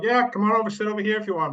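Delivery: welcoming invite. Retold or imitated. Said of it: "He would be like".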